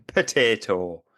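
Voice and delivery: teasing Irish pronunciation; mock Irish potato. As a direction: play up "Potato" in an Irish lilt. Mimics listener from land of potatoes.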